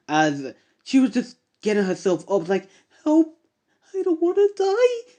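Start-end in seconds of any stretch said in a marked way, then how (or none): 3.0-5.1 very high, frightened whimpering cry; terrified plea. Acted. Battered woman begs for her life, very high terrified plea. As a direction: beg in terror. Kicked woman gets up, dead approaching.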